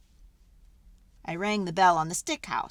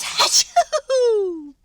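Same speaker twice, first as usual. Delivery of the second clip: big hammed-up achoo, much louder and higher; huge sneeze; 12 semitones higher, 11 dB louder than usual. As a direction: let a giant sneeze explode. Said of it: "I sneezed a great sneeze".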